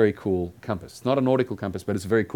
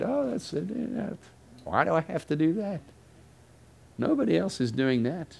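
high-pitched, put-on grumbling objection; mock reluctant complaint; 8 semitones higher than usual. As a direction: protest like an unwilling believer, starting very high. Voices the reluctant believer's objection.

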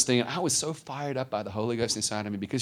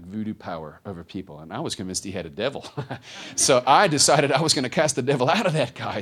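ordinary talk, nothing marked

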